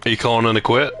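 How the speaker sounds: ordinary delivery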